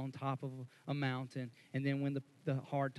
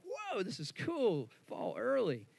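drawn-out, higher "whoa" of delight; mock surprise; 8 semitones higher, slower than usual. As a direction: act pleasantly surprised by cool weather. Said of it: "And I was like".

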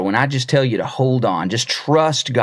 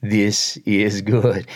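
slow, low, serene reassurance; unhurried serene catchphrase; calm friend; 3 semitones lower, slower than usual. Retold or imitated. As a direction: say it slowly and contentedly.

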